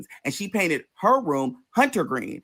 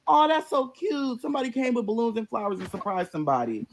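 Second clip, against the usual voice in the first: cooing; 6 semitones higher than usual. Retold or imitated. Coos at seeing someone's surprise.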